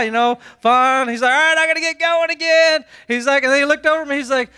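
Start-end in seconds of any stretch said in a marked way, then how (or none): 1.3-2.8 high, perky send-off; mock chipper. Mister Chipper heads off up the trail, mock chipper. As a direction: imitate an overly peppy hiker. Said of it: "He's Mister Chipper".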